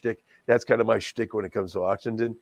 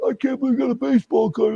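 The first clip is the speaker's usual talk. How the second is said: mock disbelief; 13 semitones higher, 4 dB louder than usual. Mock bidder reacting to prize.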